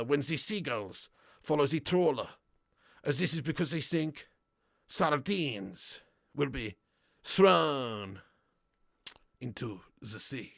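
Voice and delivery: slow, low, solemn put-on Frenchman's voice; mock philosopher. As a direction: deliver it like a deep cryptic sage, drawn out. Mock profound quote to sign off.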